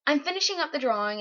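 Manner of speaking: plain reading voice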